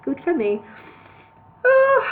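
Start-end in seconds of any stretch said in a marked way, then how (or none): 1.6-2.0 nervous squeak. Acted. Maury nervous before singing.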